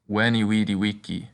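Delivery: w sounds all through "weniwidiwiki"; Latin V as W. Shown as a demonstration. Teacher demonstrating Latin V as W. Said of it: "the letter V is pronounced like a W".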